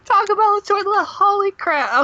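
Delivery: high squeal through laughter; laughing shriek. Real, not acted.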